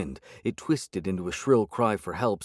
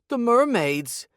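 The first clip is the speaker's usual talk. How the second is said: much higher, louder, slow panicked cry; terrified cry; 5 dB louder, slower than usual. A narrator's reading. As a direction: cry out in terror. Ada terrified.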